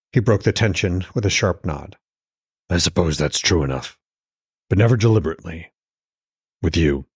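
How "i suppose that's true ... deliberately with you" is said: lightly voiced lower Odin, gruff patience; understated older husband voice. A narrator's reading.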